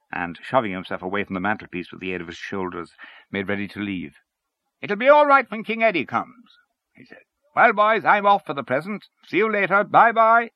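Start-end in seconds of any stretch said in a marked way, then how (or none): 4.8-10.5 cheery farewell. Acted. Hynes leaves, calling farewell.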